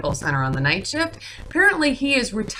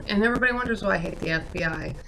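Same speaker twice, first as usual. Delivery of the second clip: ordinary talk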